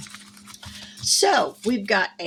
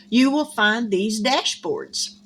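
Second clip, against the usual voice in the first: ordinary talk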